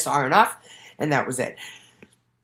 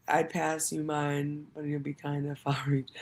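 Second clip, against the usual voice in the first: muttered quote; 8 dB quieter than usual. Retold or imitated.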